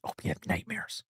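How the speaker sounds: hushed, unvoiced whisper under the goodnight; sneaky whisper after a sweet goodbye; whispered aside. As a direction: slip it in under your breath. Sly aside after a warm sign-off.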